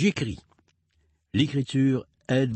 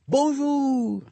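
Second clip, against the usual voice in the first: much higher sing-song call; called greeting. Pretend. Parent calling hello in the song, high sing-song call. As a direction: call hello across the room. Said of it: "crie bonjour".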